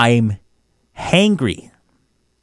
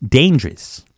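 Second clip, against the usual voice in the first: ordinary talk, nothing marked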